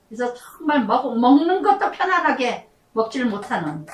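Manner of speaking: usual speaking voice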